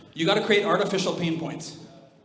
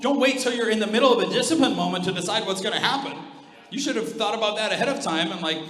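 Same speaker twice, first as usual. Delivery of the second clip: usual speaking voice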